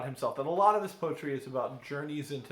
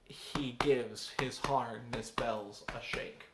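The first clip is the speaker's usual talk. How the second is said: slow, each stressed syllable of "He gives his harness bells a shake" hit; iambic reading; 5 semitones lower, 4 dB quieter, slower than usual. Shown as a demonstration. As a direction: beat the iambs out slowly. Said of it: "It's in every line".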